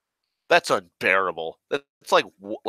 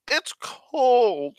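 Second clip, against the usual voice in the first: whiny mock complaint; 13 semitones higher than usual. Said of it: "I hate when people are like".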